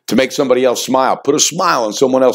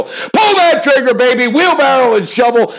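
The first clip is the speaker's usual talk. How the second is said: hyped pick call; 9 semitones higher than usual. Real, not acted. Host hypes his Golden State pick, pitched high and gleeful. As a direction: crow it like a sure thing.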